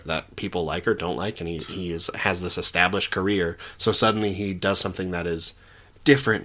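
ordinary delivery

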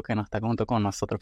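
ordinary talk, nothing marked

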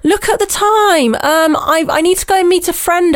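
high, slightly slow fake-polite exit; mock posh excuse, high; prissy excuse. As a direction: pitch it up like a hasty polite getaway. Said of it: "she, she goes".

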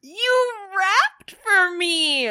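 high, loud, slow delight; excited. Real, not acted. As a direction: gush with delight. Delighted cheering at the rap.

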